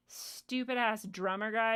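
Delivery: ordinary talk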